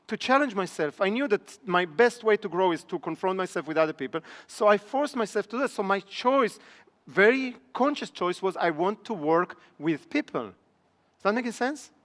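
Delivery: normal talking voice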